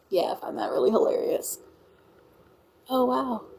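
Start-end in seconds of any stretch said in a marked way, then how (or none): none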